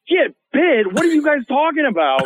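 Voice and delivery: high-pitched retold disbelief; incredulous. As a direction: question it in mock alarm, voice jumping up. Retelling his shocked reaction to warning.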